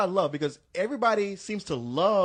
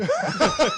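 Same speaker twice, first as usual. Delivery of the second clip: high burst of laughter; 9 dB louder than usual. Real, not acted. Laughter at friend's rave.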